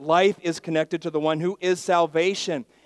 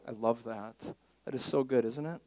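usual speaking voice

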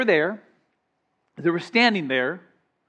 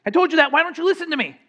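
high, indignant parent rebuke; exasperated parent; 7 semitones higher, much faster than usual. Retold or imitated. Parent to a child who never listens, indignant. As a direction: scold like a fed-up parent. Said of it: "You know, like".

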